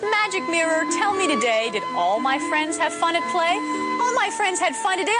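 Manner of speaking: ordinary delivery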